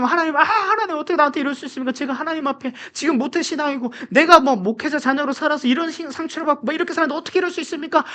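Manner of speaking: high, whining protest to God; mock resentful complaint. Believer blaming God when trouble hits, mock resentful complaint. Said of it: "막 이렇게 원망하다가".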